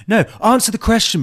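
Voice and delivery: high, loud mock exasperation; insistent demand. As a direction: demand an answer, imitating an argument. Acting out an accusation quarrel.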